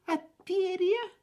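high, timid, rising little question. A narrator's reading. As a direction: ask shyly and nervously. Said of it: "спросил он робко".

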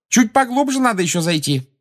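high-pitched call over the shoulder. A narrator's reading. Hermione calls over her shoulder to Umbridge, calling out. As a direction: call back to someone following behind. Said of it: "во весь голос крикнула через плечо".

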